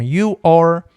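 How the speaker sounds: loud You are said as "iu or"; model pronunciation. Shown as a demonstration. Teacher showing his everyday "You are" to the viewer. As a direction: clear punchy "You are" with an open o sound. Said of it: "eu geralmente pronuncio dessa forma".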